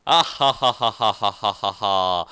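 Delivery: lowering, fading ghost cackle; fading evil laugh. Acted. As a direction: cackle and let it sink away.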